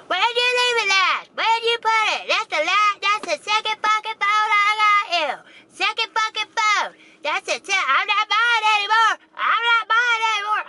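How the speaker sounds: high, loud yelled scolding; imitating a screaming mother. Mother yelling at her kid about a lost phone, screaming. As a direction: scream at the kid across the whole store. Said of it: "she was at the fucking checkout ... screaming".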